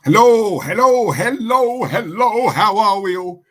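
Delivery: big loud hello to open the show; loud sing-song greeting. Real, not acted. Host greeting stream audience loudly.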